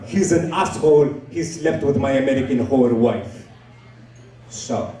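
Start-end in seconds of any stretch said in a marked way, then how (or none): 0.0-3.5 aggrieved put-on foreign accent; Abdul. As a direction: grumble in a thick foreign accent. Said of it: "his name is Abdul".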